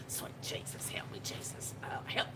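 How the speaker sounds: whispered plea in mama's voice; imitating mama. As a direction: breathe it out like mama begging Jesus. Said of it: "my mom and my mama".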